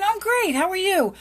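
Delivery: bright, high, beaming reply; cheerful I'm great. Retold or imitated. Answering a friend's greeting, beaming and high-pitched. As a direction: chirp it out like the best day ever. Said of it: "I say I'm great all the time".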